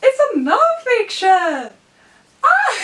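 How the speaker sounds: very high, loud delighted shriek; excited shriek. Real, not acted. Unwrapping Stephen Fry's book, she shrieks with delight. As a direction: shriek with joy at the discovery.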